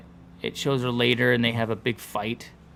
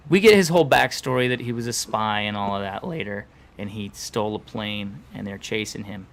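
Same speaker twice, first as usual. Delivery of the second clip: usual speaking voice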